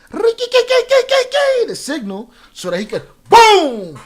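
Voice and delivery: stretched way up, then a loud burst on boom; dramatic build to boom. Acted. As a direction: draw it out slow and high, then slam boom. Building to a hit.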